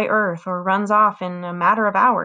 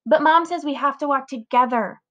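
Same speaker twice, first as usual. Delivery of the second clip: whiny little sister voice; 5 semitones higher than usual. A narrator's reading. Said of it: "in that whiny little sister voice".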